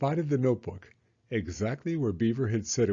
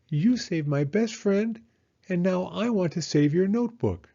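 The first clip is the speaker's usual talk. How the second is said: a determined little hedgehog; Hedgehog's voice; 7 semitones higher than usual. A narrator's reading. Hedgehog promising Annika May to fetch the notebook, high and earnest. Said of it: "announced Hedgehog".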